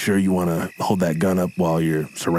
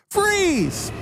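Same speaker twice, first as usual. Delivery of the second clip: very high, sharp cry of freeze; shouted command. Acted. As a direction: yell it like a cop storming in. Cop bursting in.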